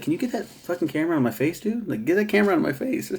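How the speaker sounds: high, fast, exasperated; hammed-up fury; mock angry rant. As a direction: snap at the cameraman, sped up. Angry father yelling at camera.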